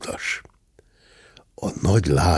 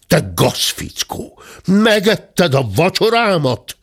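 builds to a high, loud furious yell; angry roar; 10 semitones higher, 3 dB louder, slower than usual. A narrator's reading. The squire to the cub, yelling about the eaten supper. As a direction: bellow the accusation at the cub. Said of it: "üvöltötte".

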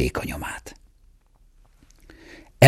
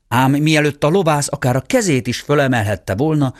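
usual speaking voice

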